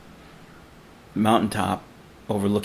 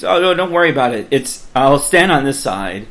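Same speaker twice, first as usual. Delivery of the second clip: quick, higher, louder retold brush-off; dismissive reassurance; 5 semitones higher, 6 dB louder than usual. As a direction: wave the worry away, acting out the artist. Artist brushing off the climber's worry.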